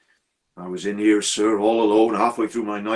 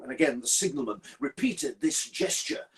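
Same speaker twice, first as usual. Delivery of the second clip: plain reading voice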